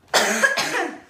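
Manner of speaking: loud real coughing fit. Real, not acted. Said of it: "my throat hurts".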